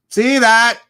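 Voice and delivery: high, loud burst; sudden high-pitched holler; loud exclamation. Real, not acted. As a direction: call it out sharply, way up high. Exclaims between the two takes.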